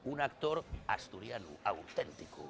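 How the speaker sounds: very low, soft mock-solemn voice; mock-dramatic hush. Actor presents himself to fellow Asturians, mock-solemn and hushed. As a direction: drop right down, quiet and grave for effect.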